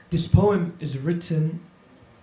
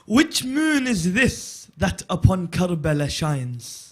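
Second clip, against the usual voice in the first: slow, lifted elegy, louder on the repeat; poem recitation; 4 semitones higher, 6 dB louder, much slower than usual. Acted. Reciter opens his Karbala poem for the gathering, mournful declamation. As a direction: declaim the lament line by line.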